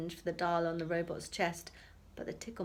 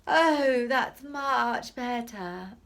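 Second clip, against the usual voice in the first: loud, very slow sigh of relief; drawn-out relieved cry; relieved shout; 5 semitones higher, 10 dB louder, much slower than usual. Acted. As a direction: relief while falling. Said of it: "long enough to shout".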